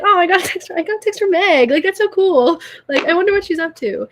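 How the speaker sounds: high, eager gush, quickening at the end; excited delight. Retold or imitated. Telling a friend how her texts land, acted-out excitement. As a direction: act out being thrilled, pitched well up.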